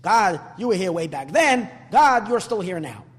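emphatic loud proclaim; loud proclamation. Shown as a demonstration. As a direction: call it out like a plain creed. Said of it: "The simple understanding of this is".

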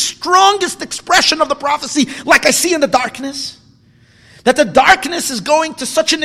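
high, loud, rousing sermon voice; soaring preacher's cry; preaching at full force. Acted. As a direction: drive it home to the congregation. Preaching build to climax.

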